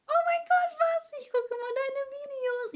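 mock a starstruck fan squealing; gushing fan. Fan meeting her in public, squealy excited imitation. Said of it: "es läuft halt immer so ab".

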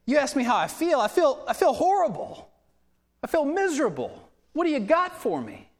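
high-pitched, worked-up mock misery; imitated preacher's miserable outburst; exasperated rant. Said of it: "this little rant on how".

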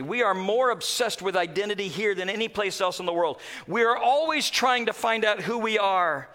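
normal talking voice

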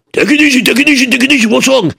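high-voiced townsfolk calming him; bystanders. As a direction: soothe the panicking man.

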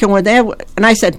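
normal talking voice

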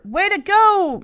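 high, loud cheer; cheering shout. A narrator's reading. Cassie cheers Michelle sliding into second base, shouting. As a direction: shout encouragement across the field. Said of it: "Cassie shouted as Michelle slid into second base".

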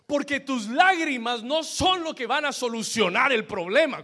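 emphatic, high-energy sermon delivery; forceful preaching. Real, not acted. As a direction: drive the point home to the congregation.

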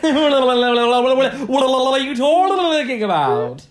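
loud, high, drawn-out garbled babble; babbling mock impression. Hosts trade insulting impressions, loud garbled babble. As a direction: gabble the words in a hammed-up squeal.

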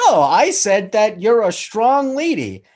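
high, slow, bright sitcom sweetness; sitcom character voice. Retold or imitated. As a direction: slip back into cheery sitcom mode. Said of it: "goes back to the sitcom character voice".

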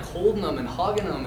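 ordinary talk, nothing marked

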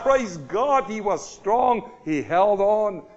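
loud, driving sermon delivery; full-force preaching. Acted. Preacher to his congregation, preaching loud and driving.

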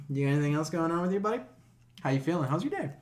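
ordinary talk, nothing marked

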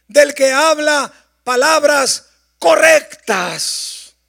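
loud, rising preacher's voice; impassioned pulpit delivery; fervent preaching. Acted. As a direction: drive the sermon home at full force. Preaching at full force.

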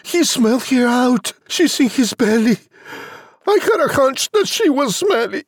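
very high-pitched, monotone character cry; Jack crying out. A narrator's reading. Jack reporting that the giant ate his mum, high cry. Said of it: "Jack cried".

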